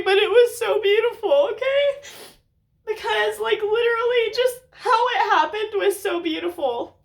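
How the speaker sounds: overwhelmed, voice breaking; tearful. Real, not acted. Tearful emotional story telling.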